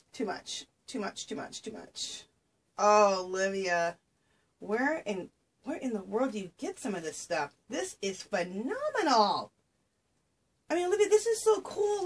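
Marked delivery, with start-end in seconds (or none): 0.1-2.2 much softer, breathless whispered repeats; overwhelmed whisper. Real, not acted. Crafter overwhelmed by Olivia's gifts, whispering. 10.7-12.0 gushing. Real, not acted. Gushing over lace piece.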